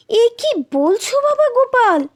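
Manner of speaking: high-pitched shocked question; startled, horrified protest; aghast. A narrator's reading. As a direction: recoil in alarm, voice jumping up. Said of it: "হাজার টাকার কথা শুনে আঁতকে উঠলেন".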